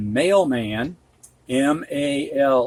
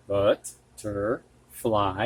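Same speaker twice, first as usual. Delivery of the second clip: butterfly. drawn out syllable by syllable, softer; slow syllables; 4 semitones lower, 3 dB quieter, much slower than usual. Shown as a demonstration. Teacher to students, slowly sounding out butterfly. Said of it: "modelling the compound word".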